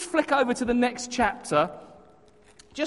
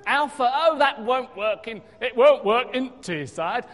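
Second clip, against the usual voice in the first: put-on Teesside accent; 3 semitones higher, 6 dB louder than usual. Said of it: "I can't even do the accent now".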